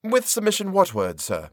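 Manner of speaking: lightly voiced deferential clerk, high on with; polite puzzled bow of a question; a hint of fawning respect; respectful Turkey. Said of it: "respectfully crowding himself".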